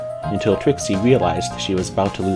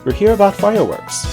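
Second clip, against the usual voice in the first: high, loud insistent correction; protesting filly; 12 semitones higher, 5 dB louder than usual. A narrator's reading. Sweetie Belle explaining their errand, protesting. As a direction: pipe up high to set it straight. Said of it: "protested Sweetie Belle".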